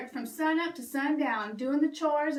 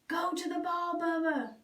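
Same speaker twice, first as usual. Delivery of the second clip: softer, otherworldly call; mysterious voice; 3 semitones higher, 3 dB quieter than usual. Acted. Unseen fairy god cow calling Bubba in the storm, softer. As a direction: call to him from nowhere. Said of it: "said the voice".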